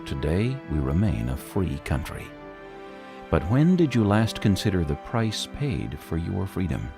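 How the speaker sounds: normal talking voice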